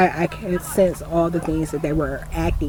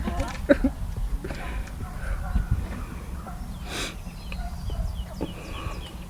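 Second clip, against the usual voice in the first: breathy giggle; 9 semitones higher, 9 dB quieter than usual. Real, not acted. Amused at neighbours coming back.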